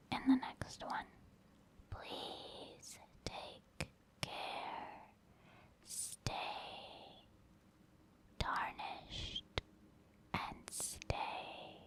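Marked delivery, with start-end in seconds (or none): none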